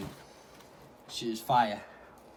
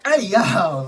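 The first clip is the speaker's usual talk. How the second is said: loud high call-out; sudden shout; 9 semitones higher, 13 dB louder than usual. Real, not acted. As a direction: yell out suddenly. Sudden call-out mid-meal.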